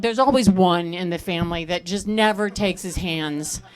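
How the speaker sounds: normal talking voice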